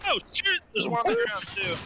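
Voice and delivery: high startled shout; startled yelp. Real, not acted. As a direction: caught off guard, yelping. Sudden enemy spotted in game.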